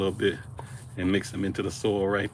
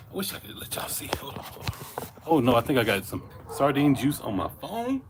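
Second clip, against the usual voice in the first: ordinary delivery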